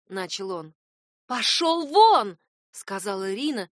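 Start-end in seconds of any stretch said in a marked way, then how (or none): 1.3-2.4 громко, резко, медленно, выше; выгони его криком; гневный крик. A narrator's reading. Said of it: "стальные ноты". (яростный крик матери)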